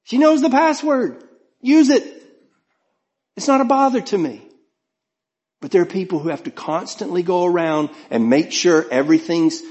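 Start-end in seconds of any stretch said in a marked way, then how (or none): 0.0-4.4 much higher-pitched, unbothered delivery; breezy dismissal. Real, not acted. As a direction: wave it off in a high, carefree voice. Waves off wife reading his emails.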